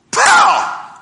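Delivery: a sudden loud slow "pow!" like the blade snapping. Retold or imitated. Preacher acting out the blade jumping off, loud slow pow. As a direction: blast out the bang of the blade popping off.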